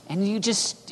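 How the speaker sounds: usual speaking voice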